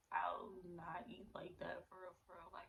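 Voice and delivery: hushed confession; real hushed confession; whisper. Real, not acted.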